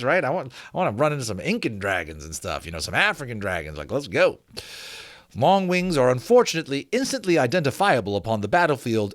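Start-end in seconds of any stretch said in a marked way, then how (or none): 5.4-9.1 measured, steady book-reading voice; story narration. Acted. Narrating a dragon history book to the viewers, in a measured reading voice. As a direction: read the old treatise like a learned gentleman.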